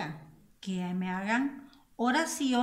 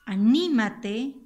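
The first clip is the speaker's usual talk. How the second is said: "Anímate" with ní punched loud and long; slow, loud "Anímate", weight on ní; stressed syllable; 9 dB louder, slower than usual. Shown as a demonstration. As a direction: hit the ní in "Anímate" hard. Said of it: "aquí hay una esdrújula".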